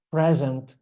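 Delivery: "present" stressed as PRE-zent; first-syllable stress. Shown as a demonstration. Retelling a Christmas gift story, "present" stressed on PRE. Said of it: "stress on the first syllable".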